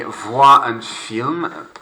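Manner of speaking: voir un film very slowly, voir as vwa; slow French model. Shown as a demonstration. Teacher models phrase for pupils to repeat, slow French. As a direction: stretch voir un film for learners.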